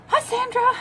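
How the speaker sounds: high, sing-song hello; greeting a viewer with high delight; cheery greeting. Real, not acted. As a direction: call out brightly to a friend. Greeting a friend watching.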